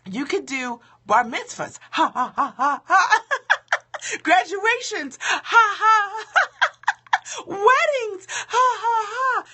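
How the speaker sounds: high, teasing laugh through the words; mocking laughter. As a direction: laugh at her like a teasing friend. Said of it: "they're laughing".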